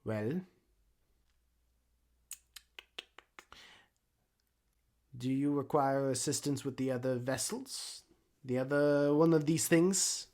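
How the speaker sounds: lightly voiced busybody gnome; hesitant gnome character voice; gnomish exhibitor. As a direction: offer help in a mild put-on accent.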